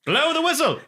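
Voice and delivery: high, loud urgent command; urgent order. Retold or imitated. Pilot yelling to his crew, urgent high shout.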